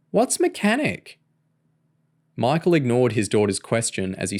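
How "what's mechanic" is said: put-on little girl voice. A narrator's reading. Small child asks father.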